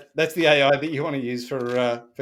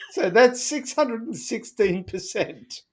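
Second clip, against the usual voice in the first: laughing; 8 semitones higher than usual. Real, not acted. Amused at strength of the beer.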